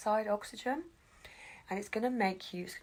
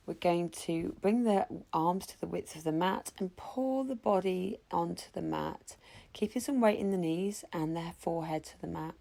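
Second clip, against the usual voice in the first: usual speaking voice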